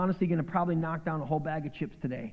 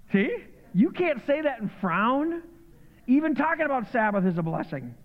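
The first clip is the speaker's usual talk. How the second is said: fired-up preaching; 7 semitones higher, 3 dB louder than usual. Acted. Preacher builds up encouragement.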